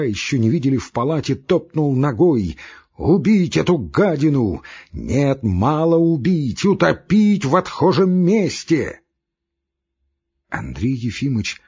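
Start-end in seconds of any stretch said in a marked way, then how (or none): 3.0-9.0 louder and higher, hateful and slowing on мало убить; furious rant. A narrator's reading. Ivan Dmitrich threatening the doctor, furious shout. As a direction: rage as if you truly mean it.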